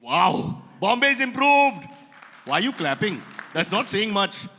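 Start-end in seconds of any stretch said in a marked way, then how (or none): 0.0-1.9 loud, high, drawn-out echo; mock amazement. Host echoing a guest's faint praise, mock amazement. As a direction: exaggerate how impressed you are.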